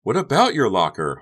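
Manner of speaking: high, loud, innocent asking; innocent question. A narrator's reading. Honey asked about the locker, playing innocent. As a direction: ask as innocently as possible. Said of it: "innocent as innocent could be".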